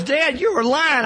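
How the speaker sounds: high, slow mock-daughter voice; teen daughter accusing. Daughter telling dad he lied, high and slow. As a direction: play the daughter catching dad out.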